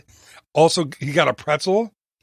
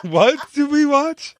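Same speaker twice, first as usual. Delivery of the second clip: surprised; 11 semitones higher, slower than usual. Real, not acted. Puzzled teasing question.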